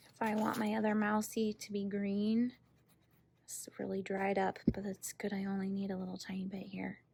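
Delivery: soft unvoiced whisper to herself. Real, not acted. Teacher murmurs to herself while painting, whispering. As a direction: murmur it under the breath while working.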